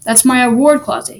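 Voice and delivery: ordinary delivery, nothing marked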